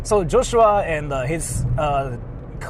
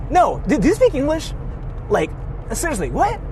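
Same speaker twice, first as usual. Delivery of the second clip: much higher, incredulous mock demand; high sneering tourist impression; mocking pushy tourist; 9 semitones higher than usual. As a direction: parody the dude's disbelief. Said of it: "the dude was like".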